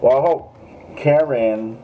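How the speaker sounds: "Karen" stretched slow with a long rolled middle; drawn-out Karen. Real, not acted. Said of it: "Why do I gotta say it like that?".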